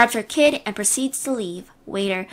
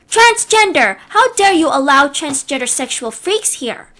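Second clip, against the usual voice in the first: outraged shriek; 2 semitones higher, 5 dB louder than usual. Acted. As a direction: shriek the word in disgust. Karen shouting at the waiter.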